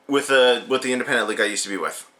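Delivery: normal talking voice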